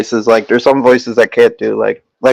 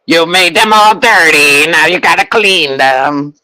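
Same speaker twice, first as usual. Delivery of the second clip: Krang voice; 3 semitones higher than usual. Pretend. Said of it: "I'm probably running out on that voice".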